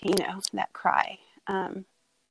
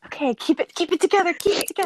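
real tears rising, voice pitched up; holding back tears; 11 semitones higher, 4 dB louder, much faster than usual. Real, not acted. Mother retelling her daughter's birth, close to crying. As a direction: fight to keep from crying. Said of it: "Oh, I'm gonna cry".

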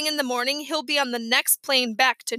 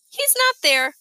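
put-on worried little boy; 10 semitones higher, 3 dB louder than usual. A narrator's reading. Fudge reports bird missing.

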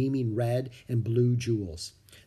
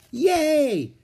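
high, loud cheer of delight; excited cheer; 7 dB louder than usual. A narrator's reading. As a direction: cheer with joy. Kids find the story.

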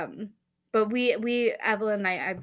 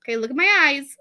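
much higher, louder, tossed-off bedtime line; rushed mock bedtime; 7 semitones higher, 9 dB louder, faster than usual. Mom rushing the bedtime blessing, mock impatient. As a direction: rattle it off like a chore. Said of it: "very, like".

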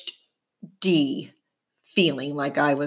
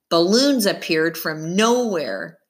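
ordinary delivery

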